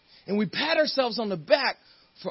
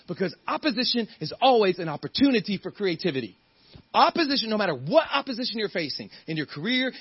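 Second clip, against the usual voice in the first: ordinary talk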